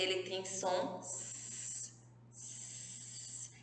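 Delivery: S drawn out as a long hiss; teacher hissing the S sound. Shown as a demonstration. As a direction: hold the S as a soft hiss. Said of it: "lembram que eu disse a vocês que o S".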